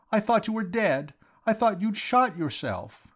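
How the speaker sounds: put-on very high, impassioned girl's voice; emotional cry. A narrator's reading. Girl who thought her sweetheart dead, high outcry. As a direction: pour out the fear in a high cry. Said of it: "she cried".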